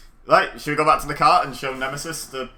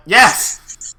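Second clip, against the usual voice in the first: exasperated yes; 7 semitones higher, 12 dB louder than usual. Retold or imitated. Exasperated retelling.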